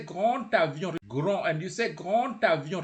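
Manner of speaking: GRAND alone, then GRAND AVION linked with T; D sounded as T. Shown as a demonstration. Teacher models GRAND AVION for repeating, D as T. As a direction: join GRAND to AVION through a T. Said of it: "it will sound like a T".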